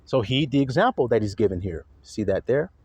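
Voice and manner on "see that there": ordinary talk, nothing marked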